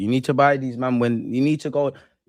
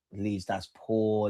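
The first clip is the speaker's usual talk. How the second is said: "Leeds, that's poor" stretched out slowly and softly, "poor" long and falling; slow disappointed drawl; 4 semitones lower, 8 dB quieter, much slower than usual. Real, not acted.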